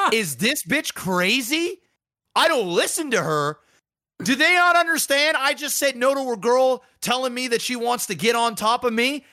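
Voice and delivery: high, hammed-up outraged boyfriend; incredulous outrage. The guy on the other end reacting, mock outrage. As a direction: act out disbelief, voice climbing high. Voicing the guy watching the video.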